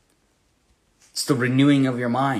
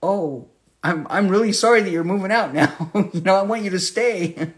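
laughing; 6 semitones higher than usual. Retold or imitated. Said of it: "my aunt was like".

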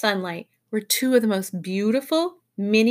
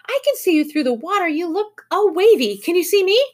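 high, loud, eager voice; excited child character's high voice; crying out excitedly; 9 semitones higher, 5 dB louder than usual. A narrator's reading. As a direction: call out high and eager through the water. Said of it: "she cried".

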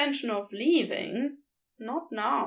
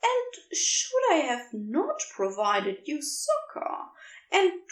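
fiendish relish; 5 semitones higher than usual. A narrator's reading. Aziraphale teasing Crowley about the accidental proposal, fiendish relish. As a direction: relish the fake awkwardness like a villain. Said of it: "they said with fiendish relish".

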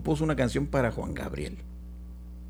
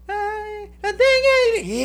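high falsetto bit; 4 dB louder than usual. Retold or imitated. Speaker imitating [name] hitting a high second voice, falsetto squeal. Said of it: "y que empieza el vato".

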